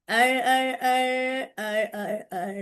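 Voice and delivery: ar ar like a whirring mixer; mixer noise. Shown as a demonstration. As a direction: imitate the mixer buzzing. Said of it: "goes ar".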